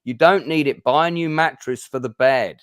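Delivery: agitated inner voice. Acted. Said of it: "guy on the other shoulder is, like, jumping up and down saying".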